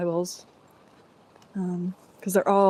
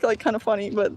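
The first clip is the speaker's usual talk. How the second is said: ordinary delivery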